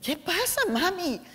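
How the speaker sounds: asking her crying mother what's wrong; worried daughter. Retold or imitated. Daughter answering mom's call.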